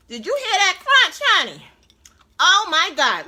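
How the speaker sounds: loud high delighted; excited. Real, not acted. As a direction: shout your delight. Loud delight after bite.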